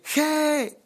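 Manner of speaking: high-pitched cut-off yell; comic retold crash scream; retold cry. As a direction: yelp one syllable, way up high. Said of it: "Solo grité".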